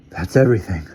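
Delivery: out of breath; panting. A narrator's reading. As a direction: gasp it out, winded. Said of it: "panted Larry Wilson".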